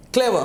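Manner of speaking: "clever" with the first syllable punched high, falling away; stress on first syllable. Shown as a demonstration. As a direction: hit the start of "clever", let the end drop. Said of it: "when you're giving stress at this k".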